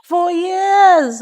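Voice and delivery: YEARS stretched long, high and loud; drawn-out emphasis. Real, not acted. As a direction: draw out YEARS with a big high swell. Host stretching the word years.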